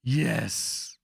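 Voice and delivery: high, sharp hissed assent; hissed yes. A narrator's reading. Grandmaster hissing agreement at the barbarian. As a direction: let the yes hiss out knowingly. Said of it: "the Grandmaster hissed".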